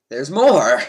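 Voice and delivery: loud burst of disbelief; surprised exclamation. Real, not acted. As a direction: cry out, caught off guard. Finds the quiz is not over.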